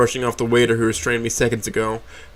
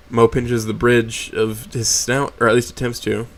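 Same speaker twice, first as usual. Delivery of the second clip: ordinary delivery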